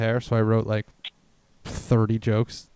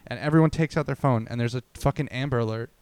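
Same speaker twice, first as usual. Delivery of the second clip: normal talking voice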